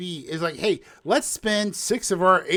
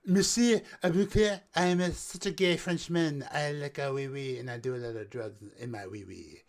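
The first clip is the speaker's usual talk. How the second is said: a lightly put-on French accent, trailing off softer; silly mock Frenchman in a French accent; 4 semitones lower, 5 dB quieter than usual. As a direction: parody a French character, getting quieter toward the end.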